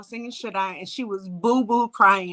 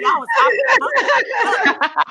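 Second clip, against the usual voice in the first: laughing; 10 semitones higher, 6 dB louder, slower than usual. Real, not acted. Showing off, laughing.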